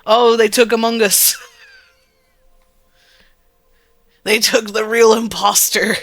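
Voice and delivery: loud, excited outburst; excited shout. Real, not acted. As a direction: yell it out in delighted shock. Shocked at the game's twist.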